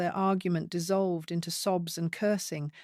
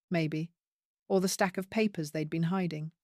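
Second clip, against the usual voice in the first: ordinary delivery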